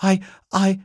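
high, halting little bear; startled stammer. A narrator's reading. Bear caught talking about the housekeeper, startled stammer. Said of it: "Paddington jumped".

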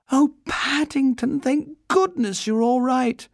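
high-pitched, fussing relief; relieved exclamation. A narrator's reading. Judy to the rescued Paddington, exclaiming with relief.

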